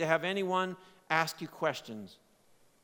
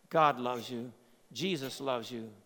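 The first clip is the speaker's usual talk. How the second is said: lower, softer: "God loves you" spoken tenderly; gentle reassurance; 6 semitones lower, 4 dB quieter than usual. Real, not acted. Preacher assuring the listeners quietly, gently tender. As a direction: let "God loves you" settle in softly.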